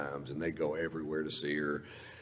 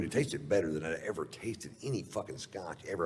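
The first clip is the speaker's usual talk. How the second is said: usual speaking voice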